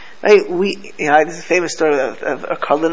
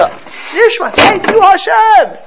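high, loud, drawn-out call; urgent cry of thanks; 13 semitones higher, 4 dB louder, much slower than usual. Shown as a demonstration. Rabbi showing students how to cry out thanks. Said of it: "we feel an urge to".

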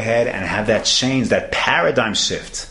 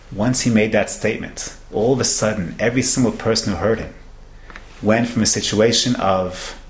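ordinary talk, nothing marked

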